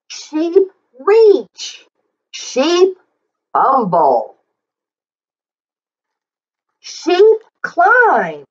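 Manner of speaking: rhythmic read-aloud, leaning on each sheep; reading to children. Acted. Teacher reading the book aloud to the class, in a lively sing-song. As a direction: read it out like a picture book for little kids.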